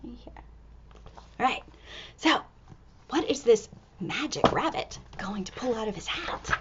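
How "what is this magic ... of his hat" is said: hushed suspense whisper. Acted. Reader to children, hushed whisper. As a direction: breathe it like a secret.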